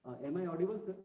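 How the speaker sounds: very faint voice far from the mic; faint, hard to hear; barely audible. Real, not acted. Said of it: "Your voice is very low".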